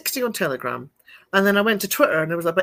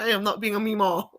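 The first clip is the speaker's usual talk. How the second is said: put-on southern accent. Mama refusing to be a grandma, pretend southern accent. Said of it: "a very southern accent together".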